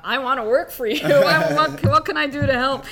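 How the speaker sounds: real giddy laugh while offering help; laughing excitedly. Real, not acted. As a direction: burst out laughing with delight. Host gushing, laughing at the end.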